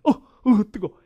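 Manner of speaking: high, loud startled ow-it's-hot yelps; mock yelping at a burn; acted burn reaction. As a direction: react as if touching something scalding. Acts out the burn again.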